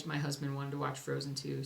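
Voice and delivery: usual speaking voice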